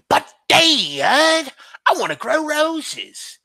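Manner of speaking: high, plaintive cartoon boy; Bobby. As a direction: whine up to Dad in a child's voice. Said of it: "Bobby from King of the Hill".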